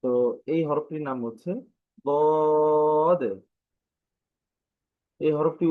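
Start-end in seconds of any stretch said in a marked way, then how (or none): 2.1-3.2 "দদ" with a long, high, steady o; drawn-out letter name. Shown as a demonstration. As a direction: hold the vowel of "দদ", then close on d. Teacher repeats the letter name.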